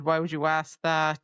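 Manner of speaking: ordinary delivery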